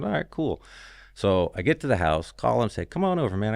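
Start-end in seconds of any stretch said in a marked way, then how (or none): 0.0-0.6 bright high "all right, cool"; a friend's casual, pitched-up okay; eager agreement. Retold or imitated. Retelling agreeing to meet friend. 2.8-3.5 high, welcoming call to come over; inviting a coworker over, pitched up; eager invite. Retold or imitated. Retelling inviting friend over.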